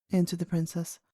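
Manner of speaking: ordinary delivery, nothing marked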